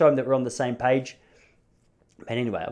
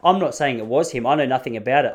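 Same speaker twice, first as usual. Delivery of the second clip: ordinary talk, nothing marked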